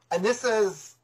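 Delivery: usual speaking voice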